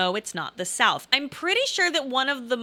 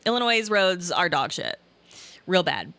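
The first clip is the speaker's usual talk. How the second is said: normal talking voice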